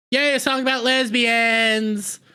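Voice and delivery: high, drawn-out cheer; mock cheering. Reviewer mocking the song announcement, drawn-out mock cheer. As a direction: stretch out a mock celebratory yay.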